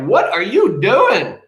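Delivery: loud, high mock-outraged demand; outraged mom. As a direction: burst in on them as a shocked mother. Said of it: "mom bursts open the door".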